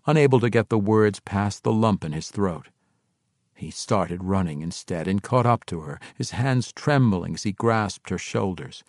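usual speaking voice

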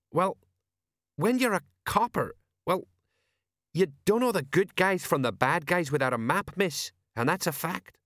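a hint of a gruff old copper; Sergeant Colon. Old sergeant explaining war to Angua, lightly voiced.

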